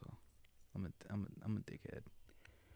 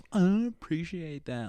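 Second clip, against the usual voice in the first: slow, higher-pitched complaint; imitated offended woman; 6 semitones higher, slower than usual. Man mimics females who don't get his sarcasm, mock offended.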